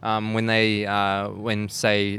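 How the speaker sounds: ordinary delivery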